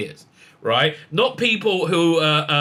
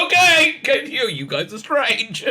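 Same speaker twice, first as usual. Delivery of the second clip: laughing disbelief; 8 semitones higher, slower than usual. Retold or imitated. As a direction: laugh it out at these oddballs. Laughing at weirdos mad at Tennant.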